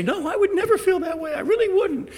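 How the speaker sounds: high-pitched pleading daughter; tearful girl. Retold or imitated. Daughter tearfully promising her parents, high tearful plea. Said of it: "in tears say".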